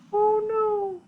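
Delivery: very high falsetto wail; squeaky mock dismay. Reacting to the peasant's seat mishap, squeaky mock dismay. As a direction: squeal it in tiny falsetto alarm.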